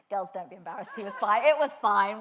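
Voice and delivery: chuckling through it, lightly; laughing while telling them not to worry; laughing reassurance. Retold or imitated. Telling embarrassed girls it was fine, laughing.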